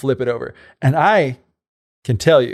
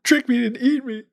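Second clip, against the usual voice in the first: high, frightened little voice; boy fearing the whale, mock scared; 11 semitones higher than usual. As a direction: whine it like a scared kid.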